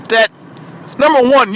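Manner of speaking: ordinary talk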